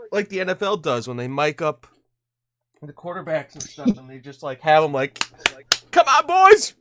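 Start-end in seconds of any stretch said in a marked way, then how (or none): 5.9-6.7 shouted high and loud; mock quarterback shout. Host imitating a quarterback rallying his team, shouting. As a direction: yell it like a fired-up quarterback.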